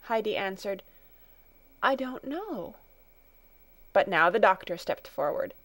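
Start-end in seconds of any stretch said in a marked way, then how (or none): 1.9-2.8 put-on small, higher child's voice, frightened; barely audible fear. A narrator's reading. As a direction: answer timidly, hardly able to be heard. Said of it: "hardly able to make her voice heard".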